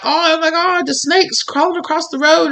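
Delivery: high-pitched startled exclamation; mock a startled onlooker, pitch up; acted alarm. Somebody spots a snake on the road, acted alarm.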